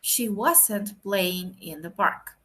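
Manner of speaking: very slow, every word spaced out; slow model sentence. Shown as a demonstration. Teacher showing the negative form, very slow and deliberate. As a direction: spell the sentence out slowly for the class. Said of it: "y el complemento que es in the park".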